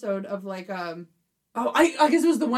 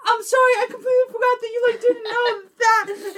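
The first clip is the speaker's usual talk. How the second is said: high, fast, loud apology, then slowing; half-laughing panicked sorry; frantic apology; 11 semitones higher, 4 dB louder than usual. Retold or imitated. Speaker apologizing to fiance, high frantic sorry. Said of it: "And I was like".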